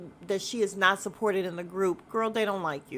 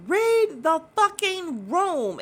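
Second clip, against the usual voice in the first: high, louder, slow, every word hammered; mock furious yell; 10 semitones higher, 5 dB louder, much slower than usual. Britt finally snapping at Angela, slow yelled. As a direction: blow up on the last warning. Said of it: "And then she's like".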